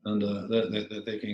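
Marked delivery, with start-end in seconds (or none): none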